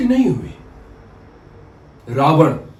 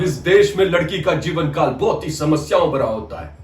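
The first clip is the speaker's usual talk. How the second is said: ordinary delivery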